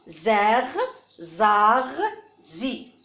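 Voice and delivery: zer, zar, zi, each alone with a buzzing z; teacher modelling the z in "zer, zar, zi"; isolated syllables. Shown as a demonstration. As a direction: sound out "zer", "zar", "zi" one by one, pausing between. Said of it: "On entend ici le son z".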